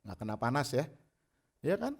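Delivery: ordinary talk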